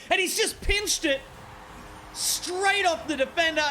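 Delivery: very high, urgent exclamation; excited winning-goal call. Real, not acted. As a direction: erupt as the shot flies in. Winning overtime shot.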